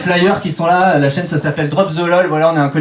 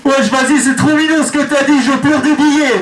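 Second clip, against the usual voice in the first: weepy street slang; 11 semitones higher, 3 dB louder than usual. Retold or imitated. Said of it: "machine reacts".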